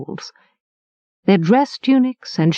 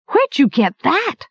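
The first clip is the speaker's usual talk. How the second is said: higher, startled question; surprised; 7 semitones higher than usual. A narrator's reading. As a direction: ask in sudden surprise. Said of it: "his eyes round with surprise".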